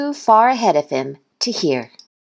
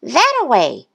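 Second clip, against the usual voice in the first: slightly raised character voice; lightly voiced bird; 8 semitones higher than usual. A narrator's reading. Bird gives Duck directions, lightly voiced. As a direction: point the way in a higher voice.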